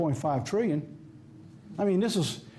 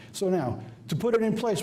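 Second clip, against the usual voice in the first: ordinary delivery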